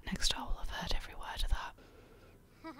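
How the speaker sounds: slow, hushed whisper. Real, not acted. Vlogger fretting that next door heard, whispering. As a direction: whisper it slowly, embarrassed. Embarrassed about neighbours overhearing her.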